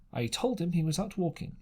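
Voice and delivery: usual speaking voice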